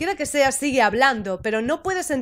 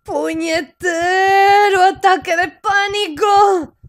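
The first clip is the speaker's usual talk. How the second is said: slow, raised, drawn-out shout; exasperated curse; 5 semitones higher, 4 dB louder, much slower than usual. Acted. Player acts cursing the panic attack, exasperated drawn-out shout. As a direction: curse it loudly, stretching every word.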